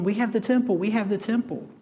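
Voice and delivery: high-pitched mock boast of the people; sneering parody of the crowd; sarcastic mimicry. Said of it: "maybe sarcastically".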